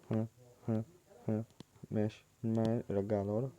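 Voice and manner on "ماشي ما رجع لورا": ordinary talk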